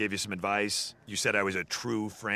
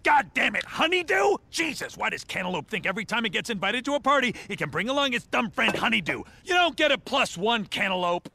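exasperated rant; 11 semitones higher than usual. Acted. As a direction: rail at the unwanted fruit in outrage. Exasperated rant about honeydew at party.

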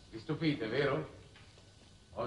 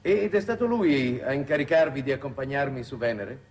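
raised over the roar; 8 dB louder than usual. Acted. Talking over rocket launch pressure.

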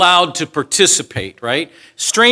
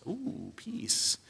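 soft, higher, hushed delight; savoring; 7 semitones higher, 16 dB quieter than usual. Real, not acted. Preacher relishing the word peace, soft and hushed. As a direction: let the word peace melt.

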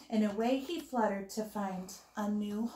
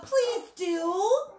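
welcoming hedgehog; 8 semitones higher, 6 dB louder than usual. A narrator's reading.

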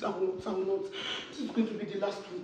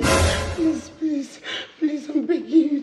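loud begging; 4 semitones higher, 7 dB louder, much slower than usual. Acted. Wife begs threatening husband.

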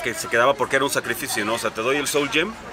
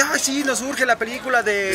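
gushing fan voice; 11 semitones higher than usual. Retold or imitated. Mocking fan excitement quote.

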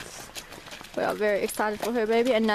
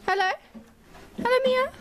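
very high sing-song hello; greeting mare; 13 semitones higher, faster than usual. Real, not acted. Greeting her mare in a high, sweet voice.

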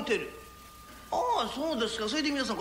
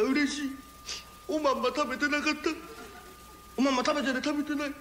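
tearful joy; 4 semitones higher than usual. Acted. ここで泣いてるやつがいる.

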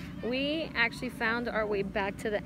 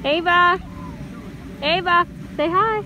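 calling out; 8 semitones higher, 7 dB louder than usual. Real, not acted. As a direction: call her name across the room. Calling to daughter across.

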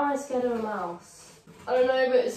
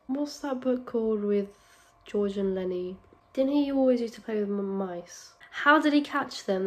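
ordinary delivery

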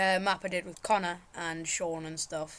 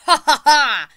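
loud, high gloating laugh; triumphant cackle; 6 semitones higher, 16 dB louder than usual. Pretend. Player gloats after owning the level, triumphant cackle. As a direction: burst out in a big "ha ha ha".

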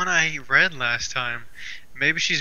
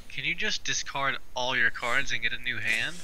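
usual speaking voice

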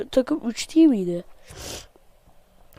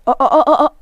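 loud, higher panicked yelps; alarmed yelps; 4 semitones higher, 10 dB louder than usual. Real, not acted. Contestant panicking as the bucket tips, loud high yelps. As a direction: yelp in alarm as the water spills.

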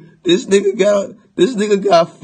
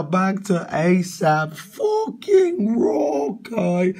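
normal talking voice

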